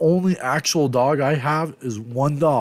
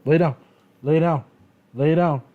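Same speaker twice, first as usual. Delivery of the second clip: a bit higher and louder, the same firm order three times; repeated dog command; 4 semitones higher, 4 dB louder than usual. Real, not acted. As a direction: drill it like training a dog.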